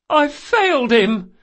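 high, slow, loud wail; tearful outburst. Acted. Weeping boy confessing failure, high loud wail. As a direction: wail it out in self-blame. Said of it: "Locke burst out".

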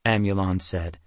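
plain reading voice

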